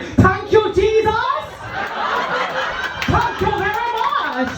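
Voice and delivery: high-pitched, hammed-up Jamaican woman rejoicing; Jamaican lady. Jamaican widow hearing her husband passed, mock rejoicing. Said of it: "I called this Jamaican lady".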